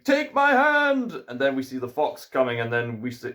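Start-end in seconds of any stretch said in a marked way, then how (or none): 0.0-1.2 high, drawn-out call; pleading high-pitched shout; urgent cry. Acted. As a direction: cry it out high, stretching each word. Acted urgent cry from story.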